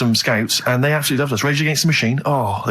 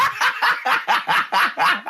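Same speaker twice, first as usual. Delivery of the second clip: long, very high cackling laugh; high laughing fit; 14 semitones higher than usual. Real, not acted. Laughs at freak nicknames.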